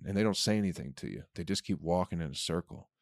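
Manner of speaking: usual speaking voice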